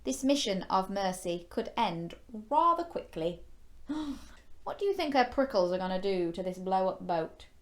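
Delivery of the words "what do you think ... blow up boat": ordinary delivery, nothing marked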